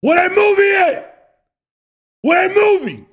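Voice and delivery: high, loud furious yell; angry aunt shout. Retold or imitated. The aunt getting mad at the kids, a high angry shout. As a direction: scream it like an aunt who lost her movie. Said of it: "she started to get mad".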